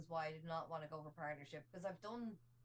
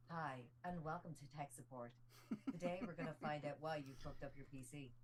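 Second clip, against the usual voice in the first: lightly voiced automated tech support line; recorded helpline greeting, lower; phone menu voice; 5 semitones lower, 3 dB quieter than usual. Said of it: "I used to be I V R for, like, uh, tech support".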